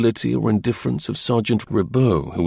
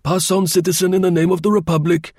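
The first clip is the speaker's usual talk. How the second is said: high-pitched official proclamation; formal order; 9 semitones higher, 3 dB louder than usual. A narrator's reading. Sergeant Ribaud lets Rateau through the gate, a formal order. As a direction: wave him through officiously, pitched well up. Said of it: "gave the formal order".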